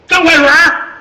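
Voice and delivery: high, loud call across the hall. Acted. Fat officer calling out to Zhang, loud and high. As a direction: hail him by his nickname.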